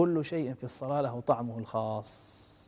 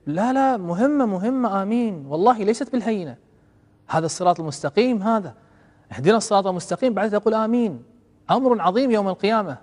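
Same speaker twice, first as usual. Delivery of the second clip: louder and higher, pressing every phrase on the listeners; preaching at full force; 6 semitones higher, 6 dB louder than usual. Acted. Preacher pleading with worshippers to mean their amen, forceful preaching. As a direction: urge them like a preacher who will not let it go.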